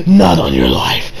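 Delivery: low, dismissive retold refusal; mock refusal. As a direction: turn him down in a dropped, deep voice. Acting friend's refusal to share.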